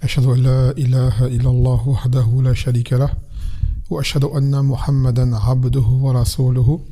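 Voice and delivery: fast, level Arabic recitation; Arabic opening formula. Acted. Teacher opens the religious lesson, formulaic recitation. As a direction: recite the formula briskly on an even tone.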